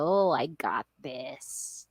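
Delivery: low, slow, soft self-assurance; superwoman confidence. Retold or imitated. Driver retelling her overconfident drive to work, cool confidence. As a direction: settle low and slow, sure of yourself. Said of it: "I feel like a superwoman".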